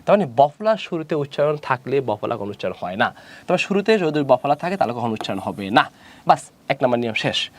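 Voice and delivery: normal talking voice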